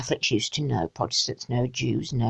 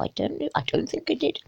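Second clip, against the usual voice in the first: usual speaking voice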